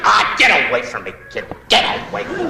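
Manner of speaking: lightly voiced gruff old actor; gruff brush-off. Comic plays Beery shooing the child away, a light gruff grumble. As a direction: shoo the kid off with a grumble. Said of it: "Wallace Beery said".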